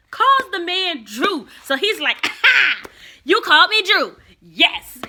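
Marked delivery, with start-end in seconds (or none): 2.2-5.0 much higher and louder, crowing with delight; gleeful triumph. Retold or imitated. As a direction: crow it like you caught her out. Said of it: "He's all happy about that".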